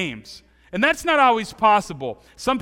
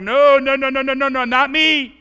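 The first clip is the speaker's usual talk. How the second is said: higher, louder frantic denial; panicked denial; 5 semitones higher, 7 dB louder than usual. Pretend. Peter denying the girl who recognized him, frantic loud denial. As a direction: blurt it out, wanting to get away.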